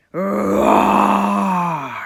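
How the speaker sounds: big roar word. A narrator's reading. Telling the class how loud the lion roared, booming. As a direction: let roar boom out loud and long.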